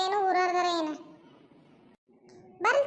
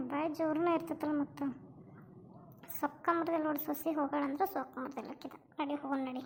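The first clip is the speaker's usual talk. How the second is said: muttered aside; 5 semitones lower, 7 dB quieter than usual. Acted. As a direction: grumble under your breath while leaving. Neighbours mutter while walking off. (ಹೊರಡುವಾಗ ಮೆಲ್ಲನೆ ಗೊಣಗುವುದು)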